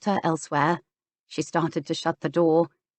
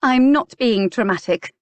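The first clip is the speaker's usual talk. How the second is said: higher, louder indignant denial; insist, stung and rising; indignant protest; 6 semitones higher, 4 dB louder than usual. A narrator's reading. Clarissa protests, rising anger.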